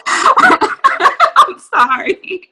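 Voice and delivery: high, drawn-out laughing sorry; helpless giggly apology. Real, not acted. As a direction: apologize through real laughter. Laughing apology after emotional speech.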